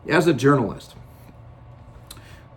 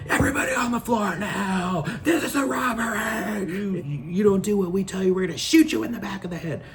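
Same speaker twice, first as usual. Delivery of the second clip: high, barked robbery orders; a bank robber yelling at the crowd to get down; robber shouting; 7 semitones higher than usual. Retold or imitated. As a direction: bark orders at the hostages like a robber. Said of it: "they say".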